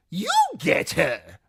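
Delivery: high, slow mocking fool; jester voice. The fool refusing Timion, high and mocking. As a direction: toss it back like a cackling jester.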